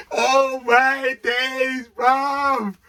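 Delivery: high, loud, slow joyful cry; overjoyed exclamation. Real, not acted. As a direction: savour the disbelief of the winner. Celebrating the winning goal.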